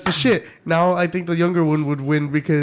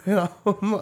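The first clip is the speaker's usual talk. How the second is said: ordinary talk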